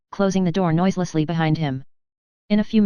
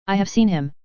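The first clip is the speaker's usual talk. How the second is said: usual speaking voice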